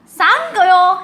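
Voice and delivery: excited shout. Real, not acted. Thanking for rockets excitedly.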